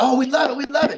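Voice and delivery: high, laughing fake gushing; high-pitched mock delight with a laugh; gushing fan voice. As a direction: play the adoring fan who loves anything. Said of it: "I'm like".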